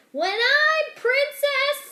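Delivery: very high, slow and loud dreaming; dreamy servant girl. Acted. Servant girl dreaming aloud of becoming princess, high and slow. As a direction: stretch it out like a grand wish.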